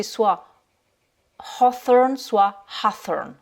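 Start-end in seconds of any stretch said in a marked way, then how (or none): 1.6-2.2 British "hawthorn" with a rounded "aw"; British accent. Shown as a demonstration. Teacher contrasts two accents for learners, British accent. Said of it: "selon l'accent britannique ou américain". 2.8-3.3 American "hawthorn" with an open "ah"; American accent. Shown as a demonstration. Teacher contrasts two accents for learners, American accent. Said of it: "selon l'accent britannique ou américain".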